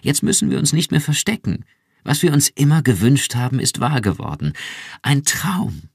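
hoch und laut, überschwänglich; begeistert. Acted. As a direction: vor Glück schwärmen. Iran schwärmt erregt. (Iran schwärmt ihrem Mann begeistert vor)

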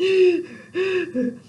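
loud, high panting gasp; exaggerated gasp. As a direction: gasp for air like a nurse. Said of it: "I'm out of breath".

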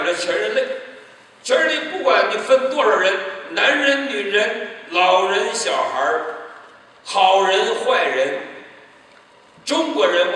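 full-force preaching. Acted. To the congregation about the two thieves, preaching at full force. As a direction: preach it out to the whole hall.

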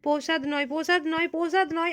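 loud, insistent repeated no. Real, not acted. Mother loudly correcting her child, insistent.

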